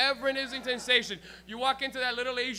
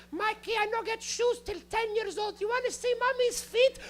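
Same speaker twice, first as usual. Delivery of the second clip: put-on high-pitched, accented mom voice; mock immigrant mother, high throughout; 14 semitones higher, 3 dB louder than usual. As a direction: imitate a mother pleading her hard childhood, pitched way up. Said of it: "And she'd be like".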